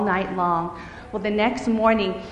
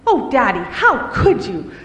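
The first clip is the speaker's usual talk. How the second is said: crying out; 6 dB louder than usual. Acted. Brooke blaming her father, loud hurt cry. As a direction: accuse him through hurt. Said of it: "Brooke cried".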